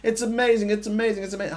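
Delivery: high-pitched gushing, imitated; mock razzle-dazzle hype. Host imitating people going on about something, mock gushing. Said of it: "They're doing this razzle dazzle of".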